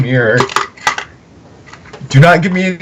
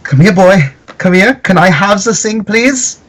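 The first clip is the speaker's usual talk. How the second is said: higher-pitched call to a pet; coaxing a pet; 7 semitones higher than usual. Acted. Player calls the animal over for the thing, high coaxing. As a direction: coax an animal over, pitch climbing to the end.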